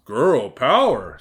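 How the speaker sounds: loud, slow, drawn-out awe; awed wolf. A narrator's reading. Wolf marvels at the magic pocket, in awe. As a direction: stretch both words in wonder. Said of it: "said the wolf in awe".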